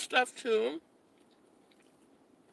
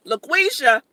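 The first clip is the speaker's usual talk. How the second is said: usual speaking voice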